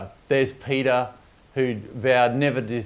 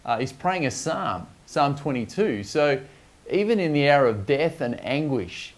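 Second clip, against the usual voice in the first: normal talking voice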